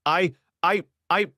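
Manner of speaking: nervous high stammer; frightened wife stammering when caught. Acted. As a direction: trip over the first word in panic. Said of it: "began to stammer".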